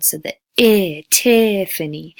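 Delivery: name for vowel color; stressed peak vowel. Shown as a demonstration. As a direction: hit the I in Tiffany. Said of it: "peak vowel sound".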